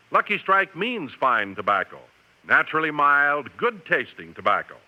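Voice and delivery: ordinary talk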